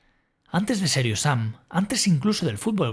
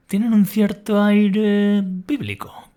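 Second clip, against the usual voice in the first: grand biblical tone; 6 semitones higher, 6 dB louder, much slower than usual. Acted.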